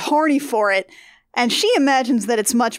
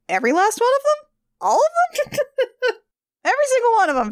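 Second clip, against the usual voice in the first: much higher, fast, squeaky disbelief; panicked Nomeo; 12 semitones higher, faster than usual. Acted. Nomeo handed weed killer, balking in a high panicked squeak. Nomeo balks at killing plants.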